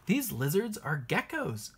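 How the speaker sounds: plain reading voice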